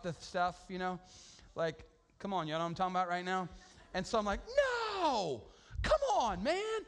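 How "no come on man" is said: high yelling at a buddy; high-pitched frustrated yell; mock yell. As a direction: shout it up high, exasperated. Yelling at friend over lost fish.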